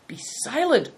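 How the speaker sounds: high-pitched, mildly acted rebuke; cried command. A narrator's reading. Prince silences Bator for speaking out, crying out. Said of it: "cried the Prince of the Belins".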